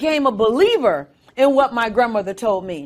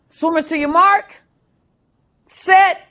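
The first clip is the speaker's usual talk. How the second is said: loud, higher announcer call; starter's call; 5 semitones higher, 4 dB louder than usual. Retold or imitated. Race starter calling swimmers to their marks, loud call.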